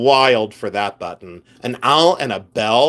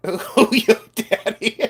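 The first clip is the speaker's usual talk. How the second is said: high, slow and giggly; teasing high-pitched goof; playful catchphrase; 8 semitones higher, slower than usual. Pretend. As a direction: draw it out high, breaking into laughter. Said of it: "I wanted to do in my".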